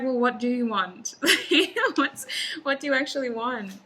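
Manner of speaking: loud question broken up by laughter; amused exasperated Spirit. Acted. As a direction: laugh while demanding an answer. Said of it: "And Spirit is like".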